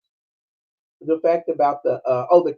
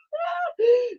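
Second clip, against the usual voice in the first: very high laughing squeal; real laughter; shrieking laugh. Real, not acted. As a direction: burst into a squeal. Laughing about fleeing guard.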